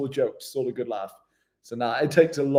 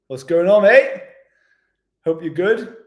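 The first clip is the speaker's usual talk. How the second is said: excited greeting; 4 semitones higher, 6 dB louder than usual. Real, not acted. Calling out greeting to viewer.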